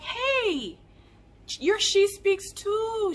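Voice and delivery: very high, drawn-out excited greeting; excited recognition. Retold or imitated. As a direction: imitate a fan squealing a slow hello. Imitating excited subscriber recognising her.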